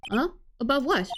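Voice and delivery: ordinary delivery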